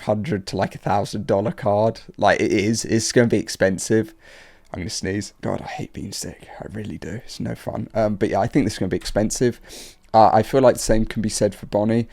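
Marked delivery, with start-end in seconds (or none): 4.7-7.9 quiet. Real, not acted. Sick, about to sneeze.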